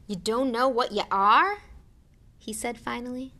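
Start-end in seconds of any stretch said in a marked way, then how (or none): 0.1-1.6 loud, bewildered question rising at the end. A narrator's reading. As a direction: ask in loud disbelief. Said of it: "bewildered stare".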